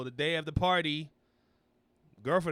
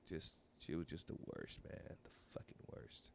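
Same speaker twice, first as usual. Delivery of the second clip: hushed grumble, nearly voiceless; a muttered confession between friends; whispered aside; 8 semitones lower, 16 dB quieter than usual. Real, not acted. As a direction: whisper it under your breath. Griping about the bad girlfriend.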